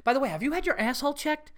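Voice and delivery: high, fast deadpan concern; a grave question asked fast and high; dead serious question. Retold or imitated. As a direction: ask it like a sincere, worried friend. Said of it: "seriously goes".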